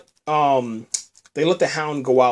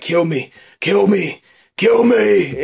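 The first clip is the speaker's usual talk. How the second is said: imitated begging, building higher and louder; mock pleading scream; 6 semitones higher, 5 dB louder, slower than usual. The dying Hound pleading with the girl, mock scream. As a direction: beg to be finished off. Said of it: "he starts screaming".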